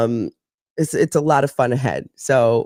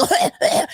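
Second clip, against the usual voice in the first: breathy laugh; 14 semitones higher than usual. Real, not acted. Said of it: "what do I sound like".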